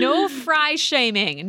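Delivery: slow, mock-stern "No fry shaming."; slow mock decree. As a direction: lay down the rule slowly and deliberately. Joking rule about fries.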